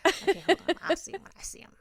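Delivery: high, flustered and rushed; flustered squeak. Retold or imitated. Wife flustered when told to flag the waiter, high squeak. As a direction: squeak it out, caught off guard.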